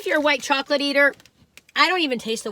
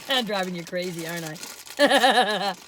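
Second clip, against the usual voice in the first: teasing drawl; 4 semitones lower, slower than usual. Real, not acted. Teasing the viewers.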